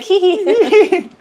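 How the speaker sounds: squeaky high hee hee giggle; a high, coy little giggle. Retold or imitated. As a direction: giggle up high like a tickled kid. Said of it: "I'm just like".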